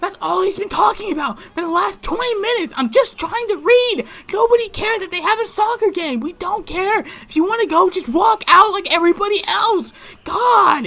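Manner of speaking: very high, loud, exasperated yelling; imitating a girl's screaming meltdown; losing it. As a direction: rant at the top of a strained high voice. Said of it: "she lost her shit".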